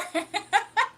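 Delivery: high, loud burst of laughter. Real, not acted. Streamer cracking up at a chat joke, loud laugh.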